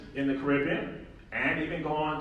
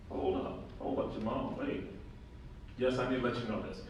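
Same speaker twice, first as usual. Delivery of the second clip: mock aside; 5 semitones lower, 10 dB quieter than usual. Interrupting himself in mock aside.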